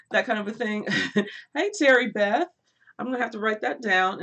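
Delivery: ordinary delivery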